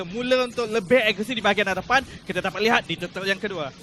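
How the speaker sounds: ordinary talk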